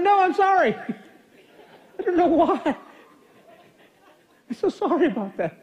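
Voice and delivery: high, sheepish laughing apology. Retold or imitated. Caught on phone, laughing mock apology.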